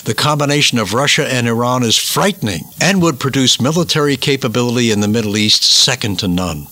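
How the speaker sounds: ordinary talk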